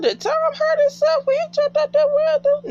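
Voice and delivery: high squeal of mock disbelief; squeaky disbelief. Real, not acted. Viewer squealing at the screen in disbelief. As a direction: squeal it up high, half crying out. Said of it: "I said".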